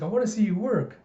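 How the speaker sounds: a hint of an eager little kid; Anya. Child asks to watch papa work, eager. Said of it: "Anya's like".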